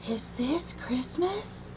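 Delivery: soft, slow, puzzled little voice; a lost little bird asks softly; small owl's hushed question. Acted. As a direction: wonder it aloud quietly, almost to yourself. Little owl asks herself, lost.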